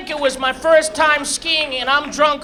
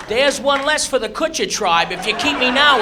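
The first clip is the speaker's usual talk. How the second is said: usual speaking voice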